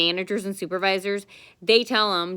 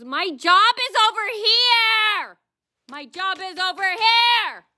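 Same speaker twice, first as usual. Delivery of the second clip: much higher, slow, fake-crying whine; mock crying whine; 12 semitones higher, 5 dB louder, slower than usual. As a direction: whimper it high like a spoiled complainer. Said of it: "and she goes".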